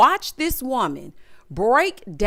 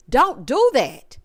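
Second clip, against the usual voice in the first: firm warning; 3 semitones higher, 5 dB louder, slower than usual. Real, not acted. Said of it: "Do not beg this man".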